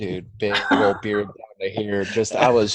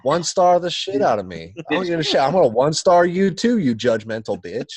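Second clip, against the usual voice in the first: raised high retold shouting at the passenger; mock yell; 7 semitones higher, 4 dB louder than usual. Driver yelling at his drunk passenger, loud mock shout. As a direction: belt it out like telling off a rude rider.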